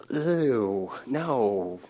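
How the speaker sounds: soft, recoiling ew and no; disgusted ew. Real, not acted. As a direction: pull back in mild disgust. Reacting to mother's womb image.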